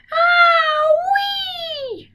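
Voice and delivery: very high yelp of pain; yelled ow-wee. Acted. Grandma yelps as the medicine hits, very high yelp. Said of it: "Grandma yelled".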